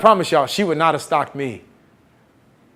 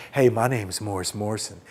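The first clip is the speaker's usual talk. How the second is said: low, soft, would-be smooth pickup voice; trying to be cool; 8 semitones lower, 8 dB quieter than usual. Retold or imitated. Grad student introducing himself to a girl on campus, trying to be cool. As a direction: play it all cool and low. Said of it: "trying to be all cool".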